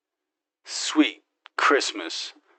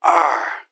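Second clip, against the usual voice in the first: startled yell; 6 semitones higher, 7 dB louder than usual. Real, not acted. Startled in firefight.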